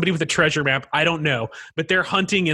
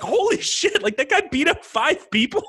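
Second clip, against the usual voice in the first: high, stunned disbelief; amazed; 11 semitones higher than usual. Retold or imitated. Moviegoer shocked by the hero's fight, amazed. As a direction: gasp it out in awe.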